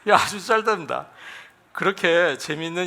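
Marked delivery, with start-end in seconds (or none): 0.1-1.1 higher, amused 야, 아주 짧답니다; amused exclamation. Real, not acted. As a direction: exclaim with delight at the punchline.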